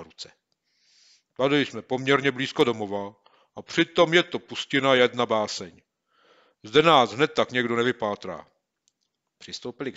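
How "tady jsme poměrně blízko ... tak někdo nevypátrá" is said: put-on a boy's voice, louder, pleased with the hideout; pleased and a bit proud. Boy to his friend, pleased with their hidden camp.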